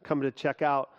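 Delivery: hushed, soft prayer voice. Acted. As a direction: pray it low and earnest. Closing prayer before sermon.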